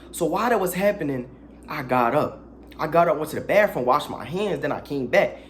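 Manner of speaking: usual speaking voice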